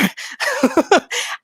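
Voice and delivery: high laugh. Real, not acted. Laughs at own story.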